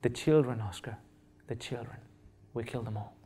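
put-on hushed, breaking voice of an old man; a hint of tears, barely above a murmur; retold crying. Old missionary weeping over the children, soft and broken. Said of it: "he started to cry and said".

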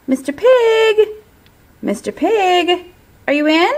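high, loud call at the door. Retold or imitated. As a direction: shout for the pig. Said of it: "I called".

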